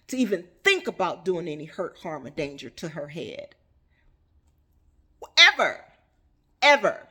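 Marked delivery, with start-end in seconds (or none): 5.4-7.1 "Ever" barked out loud, twice; emphatic shout. Real, not acted. Preacher stressing she never harms him, loud. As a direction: slam the word "Ever".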